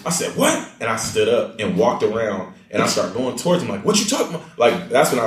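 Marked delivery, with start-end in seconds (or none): 0.3-0.7 sharp high-pitched challenge; retold confrontational outburst. 3.8-4.6 high, confrontational challenge; re-enacted street-tough indignation.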